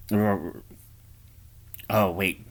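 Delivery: normal talking voice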